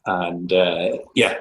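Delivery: ordinary talk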